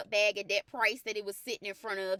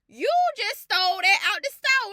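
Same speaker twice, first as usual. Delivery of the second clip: higher and much louder, a mock accusing call-out; imitating London's dad accusing; 10 semitones higher, 11 dB louder than usual. London's dad calling Coach Jess out over the sheets, loud mock accusation. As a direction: play the dad catching her stealing, loud and high. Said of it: "London's dad was like".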